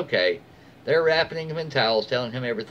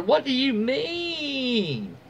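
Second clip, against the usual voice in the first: much higher, very slow indignant shriek; mock the mother's outraged squeal; entitled mother shrieking in indignant disbelief; shrill entitled mother; 12 semitones higher, much slower than usual. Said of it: "Tell the mother".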